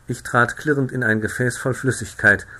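ordinary delivery

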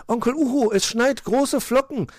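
high, loud squeal of news; excited calling. A narrator's reading. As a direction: call out to uncle, thrilled. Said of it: "rief Mauz".

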